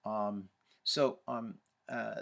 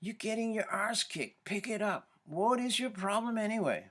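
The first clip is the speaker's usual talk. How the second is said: much higher, jeering inner critic; high mocking taunt; 11 semitones higher, 4 dB louder than usual. Runner's inner critic taunting him mid-race, high and jeering. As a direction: sneer at yourself from a high register. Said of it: "The pisser".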